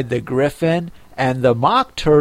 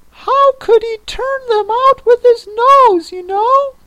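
very high, louder creature voice; persistent Mock Turtle; 5 dB louder than usual. A narrator's reading. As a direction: insist in a high, fluting turtle voice. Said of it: "the Mock Turtle persisted".

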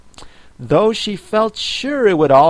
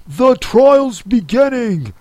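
higher, called out from afar; distant cry; 10 semitones higher, 4 dB louder than usual. A narrator's reading. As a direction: shout the news from far off. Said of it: "was heard in the distance".

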